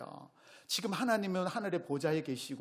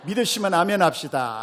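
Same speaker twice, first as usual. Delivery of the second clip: loud rousing call to the congregation; booming preacher call; loud call for amen; 10 dB louder than usual. Real, not acted. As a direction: pull an amen out of them. Calls for amen.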